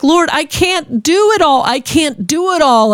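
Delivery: high, slow, tearful plea to the Lord; tearful pleading. Retold or imitated. Overwhelmed mom crying to the Lord over broken bottle, high and slow. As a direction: cry it out, overwhelmed and near sobbing. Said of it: "I just cried".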